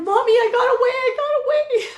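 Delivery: rescued daughter sobbing to her mother from a gas station; sobbing relief. Retold or imitated. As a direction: cry it out high with broken relief. Escaped daughter calls, crying.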